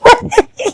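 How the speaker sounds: shrill burst of teasing laughter; high giggle. Real, not acted. Laughing at Shawa while teasing him. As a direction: laugh high at his expense.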